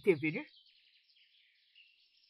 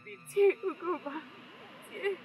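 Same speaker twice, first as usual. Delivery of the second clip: pleading; 4 semitones higher, 6 dB louder than usual. Acted. Wife begs rival to spare child.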